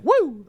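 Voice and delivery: high, loud retold whoop; whooped "woo". Partygoer leaping off the deck into the pool, whooping.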